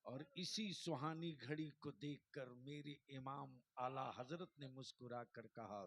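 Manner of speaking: usual speaking voice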